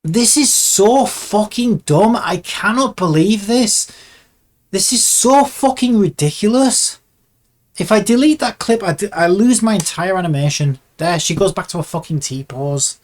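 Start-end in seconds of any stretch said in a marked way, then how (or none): 0.0-10.8 high, loud, furious rant; real exasperated anger. Real, not acted. Animator raging at software that traps the animation, exasperated. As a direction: raise the pitch and hit each complaint hard.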